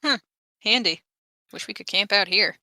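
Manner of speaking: ordinary talk, nothing marked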